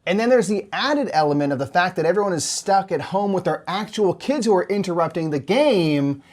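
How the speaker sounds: bright newscaster read; parody news anchor. Acted.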